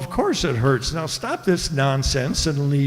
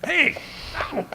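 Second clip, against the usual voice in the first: a much higher sudden yelp of surprise; startled yelp; 8 semitones higher than usual. Acted. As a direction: jump as something grabs you. Griffin lunges to bite him.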